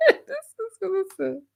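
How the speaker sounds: soft, high words squeezed through laughing; helpless laughter. Real, not acted. Streamer thanking a donor, cracking up. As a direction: try to talk through a real laughing fit.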